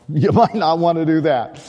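loud, laughing punchline; amused. Real, not acted. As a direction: say it through a real laugh. Joking about the Judas verse.

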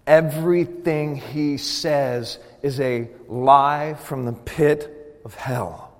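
slow, deliberate preaching, weighting each phrase; slow emphasis. Real, not acted. Preacher warns congregation about the enemy, slow and emphatic. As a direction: spell it out slowly, dropping at the end. Preacher hammers home his point.

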